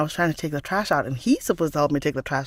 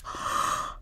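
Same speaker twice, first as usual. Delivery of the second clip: soft gasp of discovery; amazed gasp; 8 dB quieter than usual. Retold or imitated. Recalling her first taste of the seaweed, amazed gasp. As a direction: re-enact a breathy gasp of wonder.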